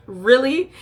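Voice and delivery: mock disbelief; incredulous really. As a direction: judgmental rising really. Reacting to making out.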